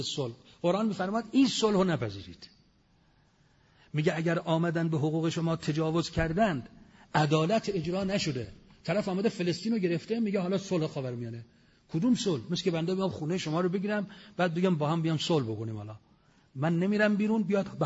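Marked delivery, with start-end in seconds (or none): none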